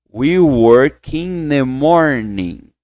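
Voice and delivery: very slow, word by word; slow drill. Shown as a demonstration. Teacher slowly leads students through the phrase. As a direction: draw each word out.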